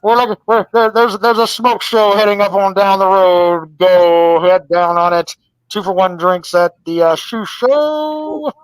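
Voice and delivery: loud mock CB broadcast, drawn-out endings; CB radio impression. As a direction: parody a bad phone line as trucker radio chatter. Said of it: "That's how you sound".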